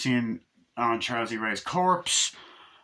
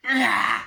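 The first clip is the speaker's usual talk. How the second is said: frustrated cry; 10 semitones higher, 5 dB louder than usual. Retold or imitated. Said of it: "she just starts going".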